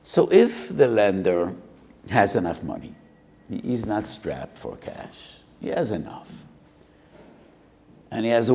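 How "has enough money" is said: normal talking voice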